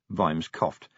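usual speaking voice

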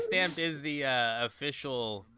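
ordinary delivery

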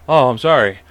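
normal talking voice

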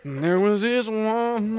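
slow, high country drawl; country accent. Pretend. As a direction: stretch it out like a country storyteller. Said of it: "get country for that".

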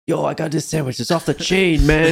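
put-on loose New York accent; a casual New Yorker hyping a sandwich. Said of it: "I can't do a New York accent".

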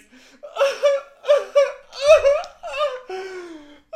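long high screaming laugh; long, loud laughing squeal; shrieking laugh. Real, not acted. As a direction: break up in a shriek. Laughs at own story.

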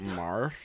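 "Mouth" said like "mar", as a question; Northern Irish pronunciation. Retold or imitated. Echoing the train announcer, "Mouth" said as "mar". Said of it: "They would say mouth".

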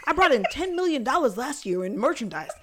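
high-pitched, hyped-up bragging; Jimmy the scammer. Acted. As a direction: pitch it like a wired hustler. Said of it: "Why are you talking so fast?".

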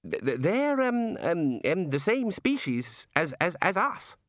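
high, stammering misery; miserable. Acted. Ponder explaining her species to the god, miserably. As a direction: squirm through it haltingly. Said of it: "said Ponder miserably".

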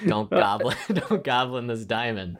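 voicing the dad's plea while cracking up; laughing through it. Real, not acted. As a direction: giggle through a mock warning to the goblin dad. Dad pleading, speaker cracks up.

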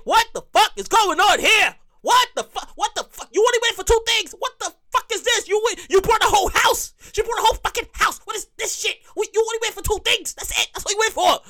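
high-pitched indignant rant; exasperated disbelief. Retold or imitated. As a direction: rant in rising disbelief at the overloaded cart. Acted outrage at the full cart.

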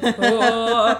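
loud comic moan; Cowardly Lion wail. Retold or imitated. Podcaster demonstrates how fake Jack sounds, Cowardly Lion moan. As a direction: wail pitifully, badly faked. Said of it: "sounds like the Cowardly Lion".

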